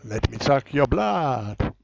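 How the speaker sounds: slow, drawn-out vampire menace; hammed-up vampire. Prank caller threatens the scammer as a vampire, hammed-up menace. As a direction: play a spooky vampire, stretching the words.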